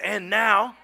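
loud, emphatic preacher's declaration; big, drawn-out pulpit proclamation; from the chest. Shown as a demonstration. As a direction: proclaim it slowly with full chest force. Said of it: "say it with your chest".

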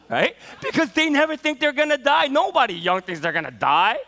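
normal talking voice

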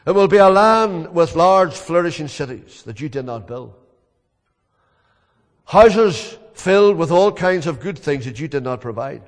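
loud, ringing sermon voice; preaching at full force. Acted. Preacher voicing Paul's testimony, loud and fervent.

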